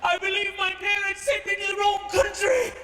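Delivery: high-pitched little British schoolboy; British schoolboy accent. Acted. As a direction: protest in a high British child's voice. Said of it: "I spoke British English".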